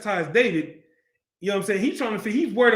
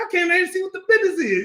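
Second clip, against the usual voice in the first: high, rapid mock retort; David's defiant retort; 10 semitones higher, much faster than usual. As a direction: fire it back fast and high-pitched. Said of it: "he like, he probably like".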